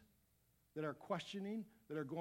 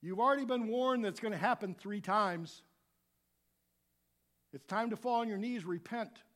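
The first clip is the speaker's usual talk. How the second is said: louder and higher, chiding Peter; mock scolding; 5 semitones higher, 9 dB louder than usual. Preacher scolding Peter for two denials, louder. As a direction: call Peter out like a buddy scolding. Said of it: "You think".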